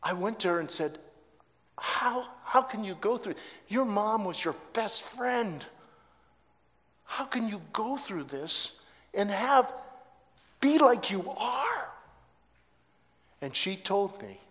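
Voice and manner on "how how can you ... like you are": high-pitched, bewildered pleading; incredulous. Retold or imitated. Widowed father asks daughter how she copes, incredulous.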